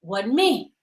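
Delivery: low, flat denial; lightly voiced man. Cheating man denying.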